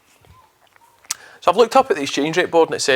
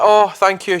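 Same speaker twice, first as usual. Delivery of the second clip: mock cashier; 6 semitones higher, 3 dB louder, slower than usual. Imitating the cashier.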